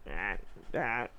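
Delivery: low, quiet mock grunts; imitated sullen parent. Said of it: "she was so sullen on the phone".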